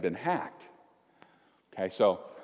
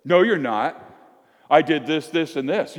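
raised, indignant comeback; defensive retort; 5 semitones higher, 4 dB louder than usual. Acted. Disciple retorting to a rival, indignant. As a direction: push back, listing your deeds.

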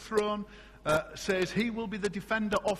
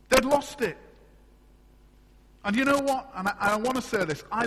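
raised, higher preaching voice with punch; full-force preaching; 7 semitones higher, 6 dB louder than usual. Acted. Preacher rousing his church about lost direction, full-force preaching. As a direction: drive the point home to the congregation.

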